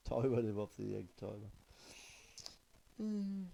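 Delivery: ordinary talk, nothing marked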